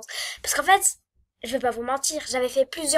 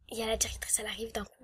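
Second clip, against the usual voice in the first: chuchoté; 9 dB quieter, faster than usual. Retold or imitated. Arrivée soudaine de la directrice.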